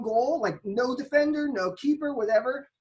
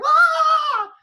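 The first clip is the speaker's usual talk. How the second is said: sudden squeal, much higher and louder; Carragher's squeal; 9 dB louder than usual. Retold or imitated. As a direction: yelp like a shocked pundit. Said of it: "The high-pitched squeal that Carragher let out".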